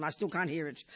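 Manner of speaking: ordinary talk, nothing marked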